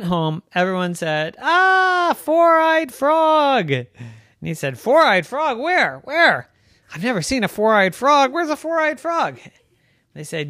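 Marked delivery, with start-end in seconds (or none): 1.4-3.7 high, slow teasing jeer; sing-songy schoolyard taunt. Acted. Everyone taunts Gilbert. 4.8-9.4 squeaky, confused little frog; bewildered high cartoon frog. Gilbert looks around confused.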